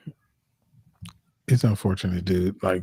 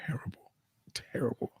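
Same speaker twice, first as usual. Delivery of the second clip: whisper; 15 dB quieter than usual. Real, not acted. Mocking their own English accents.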